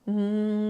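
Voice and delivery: steady bee-like hum; humming. Shown as a demonstration. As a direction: hum with lips closed. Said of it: "as you are humming".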